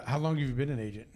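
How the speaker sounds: ordinary delivery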